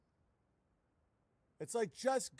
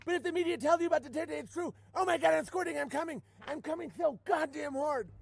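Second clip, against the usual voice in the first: higher, louder mock orgasm moans over the media; mock ecstatic moaning; 7 semitones higher, 4 dB louder than usual. Parodying a Q fan, loudly mock moaning. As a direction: ham up a Q fan climaxing.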